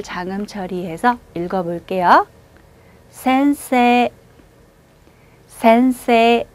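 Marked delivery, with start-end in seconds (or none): 3.1-6.5 센세 with the last 세 held long, slow; 센세, draw the 세 out; long vowel. Shown as a demonstration. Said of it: "세 한 번에 길게 발음해". (센세 세를 길게)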